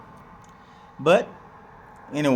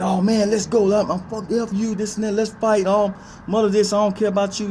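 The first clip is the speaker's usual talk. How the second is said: mock hothead; 11 semitones higher than usual. Hypothetical self picking a fight with coworker, high angry mock shouting. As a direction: play the hothead ready to jump up and fight. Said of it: "I coulda re-, jumped up and".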